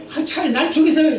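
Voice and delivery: 더 높게, 투정하듯 내뱉는 죽여 달라는 말; 심술 난 예언자가 하나님께 떼쓰듯; 토라진 요나. Retold or imitated. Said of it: "저들에게 복을 주니까 뭐예요". (요나가 하나님께 투정하며 더 높게 흉내 낸 대사)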